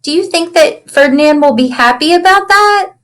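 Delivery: plain reading voice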